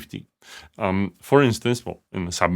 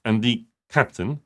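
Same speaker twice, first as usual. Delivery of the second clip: ordinary delivery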